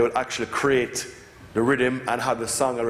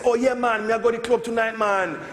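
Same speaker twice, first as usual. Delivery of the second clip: much higher, Jamaican patois with a mock Japanese accent; 10 semitones higher than usual. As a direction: play the Japanese kid answering in eager patois. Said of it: "And they say".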